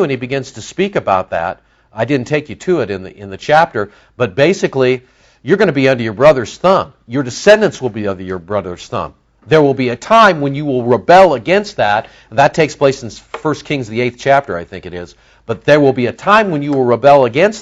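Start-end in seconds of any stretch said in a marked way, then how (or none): none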